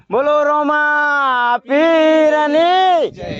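loud, high, very drawn-out chant; devotional cheer. Real, not acted. Leading pilgrims in praise of the saint, long loud cheer. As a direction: stretch each word into a rallying call.

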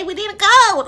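normal talking voice

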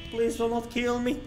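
ordinary talk

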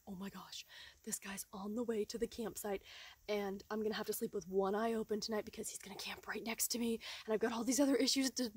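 fast, frightened whisper of racing thoughts; anxious whisper. Retold or imitated. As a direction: whisper your panic to yourself.

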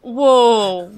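a loud, startled whoa; shocked whoa. Real, not acted. Cohost reacting to a joke about Hitler, loud startled whoa. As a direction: blurt it out, caught off guard by the joke.

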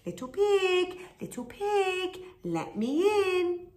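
high sly sing-song wolf call. A narrator's reading. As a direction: call out coaxing to the pig. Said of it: "he called".

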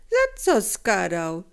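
loud, rising protest; mounting string of indignant questions; indignant dealer. A narrator's reading. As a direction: argue back offended. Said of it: "szarpnął się handel".

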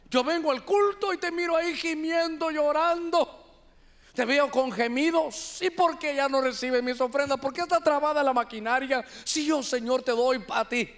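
tearful complainer. Acted. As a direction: play a churchgoer moaning that blessings stopped. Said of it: "¿sabe qué decía el Señor?". (feligrés quejumbroso que reclama llorando)

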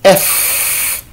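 the letter F breathed out unvoiced; sounded F. Shown as a demonstration. Teacher shows the class how F sounds, breathed. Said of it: "quand je dis".

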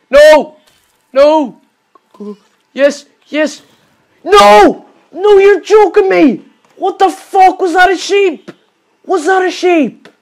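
loud, high yelling in disbelief; real gamer rage, high and loud; despairing shouts. Real, not acted. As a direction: scream at the screen as it all goes wrong. Sheep blows up his machine again.

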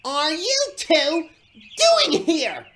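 high, loud, furious demand; cross shout with pauses for force. A narrator's reading. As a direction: shout it slowly, word by word, in outrage. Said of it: "he wheezed crossly".

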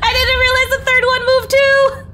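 high, loud wail through laughter; laughing shriek. Real, not acted. Player caught out by a moving obstacle, laughing shriek. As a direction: squeal it out while cracking up. Laughing after startled death in game.

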